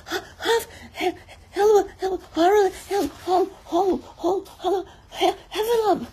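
high, panting panicked squeals; Piglet crying out. A narrator's reading. Piglet scampering off as hard as he could, crying out breathless. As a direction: gasp and shriek as if running for your life. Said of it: "crying out".

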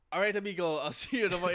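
put-on jaunty farewell in a playful Mexican accent; lightly mocked Mexican-accented sign-off; imitated Mexican accent. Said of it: "I think he's Mexican".

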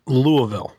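"Louisville" slurred as "Loo-a-vull"; local pronunciation. Shown as a demonstration. Panelist explains Kentucky names, "Louisville" as "Loo-a-ville". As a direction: say "Louisville" with the middle swallowed to "uh". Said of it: "you will pronounce it Louisville".

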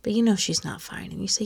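ordinary talk

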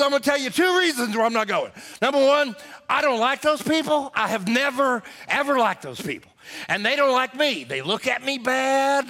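high, worked-up mock-husband grievance; whiny protest. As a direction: complain like a sulking stubborn husband. Acted husband complaining in quarrel.

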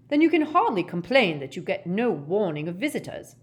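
crisp rebuke with indignation; tart. Acted. Ogden answering Gaunt's excuse, tartly. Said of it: "said Ogden tartly".

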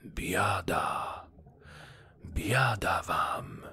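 a distant voice crying doom in dread; mysterious voice wailing in terror; fearful cry. A narrator's reading. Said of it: "głos jakiś wołał z trwogą".

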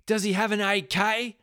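high, loud shout from inside the house. Retold or imitated. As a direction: shout the question out from another room. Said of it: "another voice from inside the house goes".

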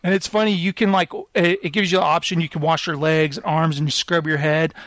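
usual speaking voice

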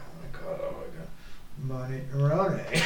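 whispered, panicky-quick mutter to oneself; barely voiced, then a low murmured name; under the breath. Prompted while out Sunday shopping, muttering under the breath. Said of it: "under my breath".

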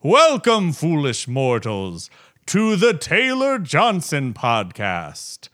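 slow theatrical villain menace; slow, drawn-out haunted house narration; slow spooky ghost host. Retold or imitated. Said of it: "the monologue from the Haunted Mansion ride".

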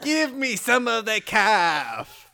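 big drawn-out mock delivery; hammed-up echo. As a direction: outdo the copycat, stretching every word. Tops the echo, even more exaggerated.

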